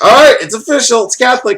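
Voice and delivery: loud, high-pitched proclamation; hammed-up excited ruling; mock triumphant announcement. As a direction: imitate a dad jubilantly declaring it settled. Voices dad announcing the decision.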